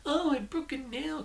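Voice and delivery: high mock-prissy complaint; mocking a prissy woman. Vlogger mocks Willie as a sheltered damsel, high and prissy. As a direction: whine it like a pampered damsel. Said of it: "she is this stereotypical".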